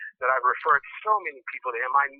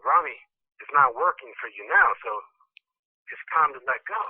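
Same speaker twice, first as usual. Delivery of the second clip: ordinary talk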